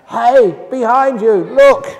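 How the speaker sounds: loud high yell of a moviegoer; shout at the screen. Retold or imitated. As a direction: yell a warning to the hero on screen. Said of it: "somebody else would shout out".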